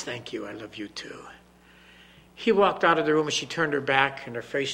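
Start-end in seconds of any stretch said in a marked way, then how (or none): 0.0-1.2 soft whispered thanks; tender whisper. Acted. Father thanks his daughter in a tender whisper. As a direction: whisper it gently. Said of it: "He says".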